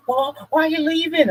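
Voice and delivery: high mock-innocent squeak of the ex. Man mimics his ex's fake surprise as he walks out, high mocking squeak. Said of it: "she's like".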